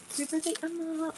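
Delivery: high, hushed birthday wish; soft birthday whisper. Real, not acted. Mother whispering happy birthday to sleeping Emma, hushed. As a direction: breathe it so she stays asleep.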